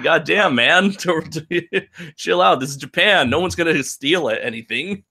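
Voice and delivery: high, laughing mock voice telling him to chill; high, amused imitation; mock reassuring dude. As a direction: play a laid-back local, laughing through it. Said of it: "It's like".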